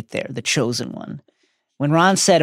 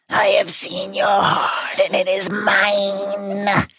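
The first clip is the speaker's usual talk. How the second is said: high, very slow, drawn-out villain voice; hammed-up possessed-locket voice; sinister Horcrux voice; 8 semitones higher, much slower than usual. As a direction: intone it like an evil spirit, stretching the last word. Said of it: "the Horcrux speaks".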